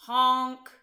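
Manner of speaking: loud blaring honk; loud truck honk. A narrator's reading. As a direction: blast it like a big horn. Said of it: "yelled a dump truck".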